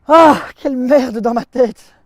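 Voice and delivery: loud, high cry of torment; exasperated outburst. Acted. Soldier sick of the song in his head, crying out. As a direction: curse your own head out loud.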